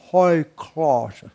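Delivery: mild mock British announcement accent. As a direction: lightly imitate a British airport announcer accent. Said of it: "which I'm again trying to imitate".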